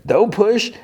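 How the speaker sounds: high-pitched mock protest; imitated indignant princess; acted protest. Princess objects to being shoved.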